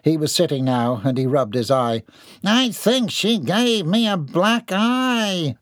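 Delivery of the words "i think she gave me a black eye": high, slow self-pitying; whiny complaint. Acted. John whines about his injury. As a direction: whimper about your eye.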